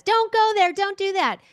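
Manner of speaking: high, panicked warning cry; screaming inner voice. Retold or imitated. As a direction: shriek it like a frightened inner voice. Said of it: "screaming at us".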